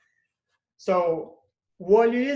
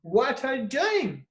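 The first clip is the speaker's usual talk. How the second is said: higher, slower exclamation; strong feelings; 6 semitones higher than usual. Shown as a demonstration. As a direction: let the upset show, slowly. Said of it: "to show your strong feelings, you say".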